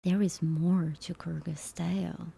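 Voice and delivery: hushed breathy whisper. Pretend. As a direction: whisper it like a secret. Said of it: "I wonder if I could sound like them".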